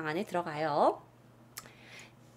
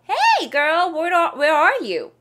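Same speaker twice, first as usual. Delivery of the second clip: calling out to a friend; 6 semitones higher, 8 dB louder, much slower than usual. Acted.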